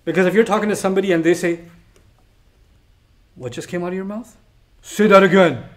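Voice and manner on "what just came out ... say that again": acted indignant confrontation, building from restrained to loud; offended, rising questions; affronted challenge. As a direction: challenge them to repeat it. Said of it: "When somebody talks like that".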